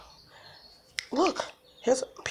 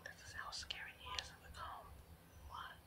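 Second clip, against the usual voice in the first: quick breathy whispered aside; hushed commentary to the viewers; 25 dB quieter than usual. Real, not acted. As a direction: whisper it fast behind the camera. Aside while filming the cat.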